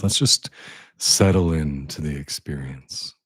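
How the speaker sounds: slow, drawn-out meditation guidance; guided meditation. Real, not acted. Guide easing listeners into the experience, slow meditation voice. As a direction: linger on the words, leaving space between phrases.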